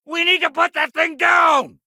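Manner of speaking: high, loud yell to the squad; urgent combat shout. Acted. Officer urging troops to put the creature down, shouting.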